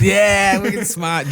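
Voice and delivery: Boston accent. Shown as a demonstration. Echoing the Boston phrase back, loud Boston accent. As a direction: belt "wicked smart" like a proud Bostonian.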